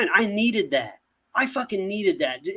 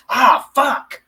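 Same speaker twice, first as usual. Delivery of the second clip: loud, raised-pitch mock curse; imitated irritated outburst; 8 semitones higher, 9 dB louder than usual. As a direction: act out an exasperated curse. Imitating Hugh Jackman's irritated outburst.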